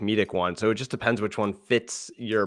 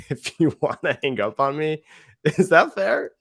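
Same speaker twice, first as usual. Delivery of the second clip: high, louder, playful question; friendly check-in; 6 semitones higher, 5 dB louder than usual. Shown as a demonstration. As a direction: ask it with a grin in your voice. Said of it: "It's friendly. It's warm".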